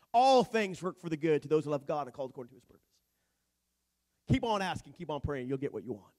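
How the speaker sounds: loud, driving preacher voice; full-force preaching. Acted. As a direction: proclaim it to the whole room.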